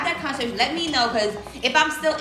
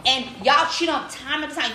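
normal talking voice

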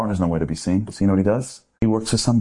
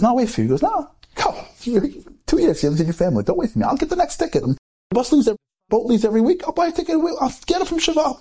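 high, insistent urging to go home; pleading friend voice. Acted. Friend urging Baruch to take the boat, high and insistent. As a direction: push him to leave, voice raised high. Friends arguing who waits behind.

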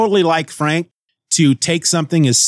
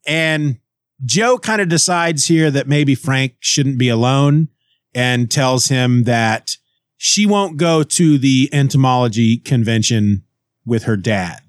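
ordinary talk, nothing marked

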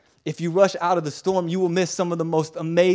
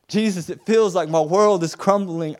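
high, loud plea; acted anguished prayer; desperate cry; 4 semitones higher, 6 dB louder than usual. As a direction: cry out to Jesus as everything collapses. Voicing a believer whose world crumbles.

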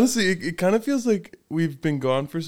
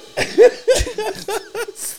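spit take. Real, not acted. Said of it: "How did that do it twice?".

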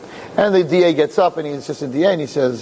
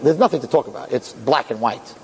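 ordinary delivery

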